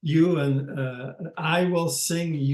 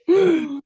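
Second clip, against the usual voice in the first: a high, loud burst of real laughter; 13 semitones higher, 8 dB louder than usual. Real, not acted. Speaker laughs at his own memory.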